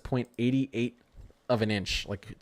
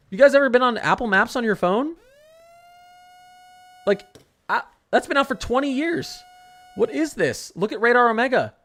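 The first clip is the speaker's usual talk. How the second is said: high, loud incredulous ranting; outraged at the website; shrill disbelief rant; heated rant; 10 semitones higher, 6 dB louder than usual. Real, not acted. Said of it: "Stop getting me heated".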